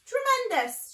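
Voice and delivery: high, loud exclamation; delighted shout. Acted. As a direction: shout it out in hearty approval. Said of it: "shouted Badger".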